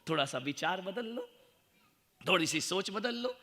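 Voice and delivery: ordinary delivery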